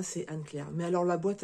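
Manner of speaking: ordinary talk, nothing marked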